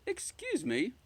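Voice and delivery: high, sweet polite witch. Acted. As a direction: call out politely from the door. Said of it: "I said politely".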